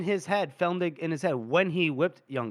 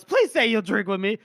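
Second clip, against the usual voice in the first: loud, high call for a cheer; rousing toast; 3 semitones higher, 3 dB louder than usual. Acted. Felnik toasting Colonel Gorst, loud and hearty. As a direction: proclaim it to a rowdy room, easing down at the end.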